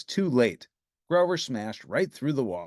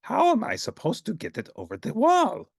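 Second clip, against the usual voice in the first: Grover voice; 10 semitones higher, 4 dB louder than usual. Acted. Grover straining.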